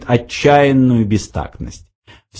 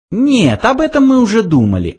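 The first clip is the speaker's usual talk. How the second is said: quick, high little mouse voice; dismissive mouse; 12 semitones higher than usual. A narrator's reading. Mouse rejects Arthur's guess, quick high voice. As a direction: brush the idea away, high and quick. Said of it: "ответил Фрэнки".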